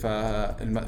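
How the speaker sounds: normal talking voice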